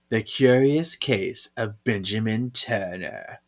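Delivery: normal talking voice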